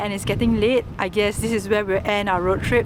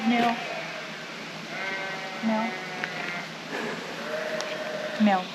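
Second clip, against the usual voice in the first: soft repeated meows; cat meows; 11 dB quieter than usual. Pretend. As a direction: meow gently like a cat. Meowing at the cats.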